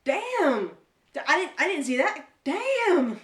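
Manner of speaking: loud, high shocked exclamation; surprised. Retold or imitated. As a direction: blurt it out, stunned by the twist. Retelling reaction to twist ending.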